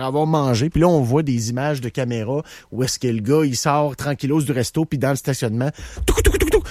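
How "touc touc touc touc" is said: rapid high-pitched "touc-touc" footsteps; bruit de course imité. Retold or imitated. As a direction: imitate scurrying feet, high and staccato. Imite le bruit de la fuite. (petits bruits de pas aigus, imités à la bouche)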